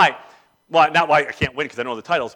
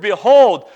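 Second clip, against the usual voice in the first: "behold" loud, leaning on "hold"; emphatic behold; 3 semitones higher, 9 dB louder than usual. Shown as a demonstration. Preacher marvelling at one word of scripture, emphatic "behold". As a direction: set "behold" apart and proclaim it. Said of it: "thinking deeply about the word".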